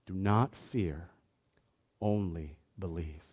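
Jesus quietly comforting the father, softly; gentle reassurance. Retold or imitated. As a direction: lay it down tenderly, word by word.

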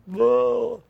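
high falsetto wailing cry; a hammed-up mourner's howl; mock wail. Imitating sobbing mourners.

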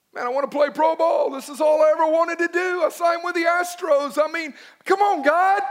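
loud, high complaint to God; pleading protest. Acted. Young ballplayer protesting to God about his career, pleading loudly. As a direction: raise the voice in exasperated pleading.

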